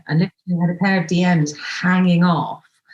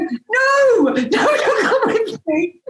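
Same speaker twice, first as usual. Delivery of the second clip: laughing no; much slower than usual. Retold or imitated. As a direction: shout no through laughter. Refusing date's offer, laughing.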